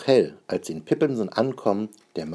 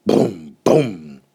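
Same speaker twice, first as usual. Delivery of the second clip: lauter Paukenschlag; 8 dB louder than usual. Acted. Pauke schlägt.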